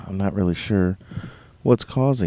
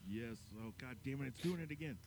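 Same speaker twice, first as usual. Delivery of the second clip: faint echoing repeat; 2 semitones higher, 22 dB quieter than usual. Real, not acted. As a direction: sound like an echo bouncing back. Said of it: "that echo".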